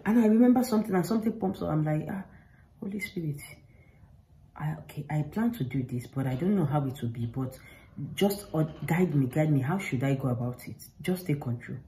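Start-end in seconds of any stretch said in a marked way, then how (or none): 2.1-11.8 soft, near-whispered plea for guidance; hushed murmur asking to be guided; whispered prayer. Retold or imitated. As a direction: breathe the prayer quietly over the sink. Retold prayer while doing dishes.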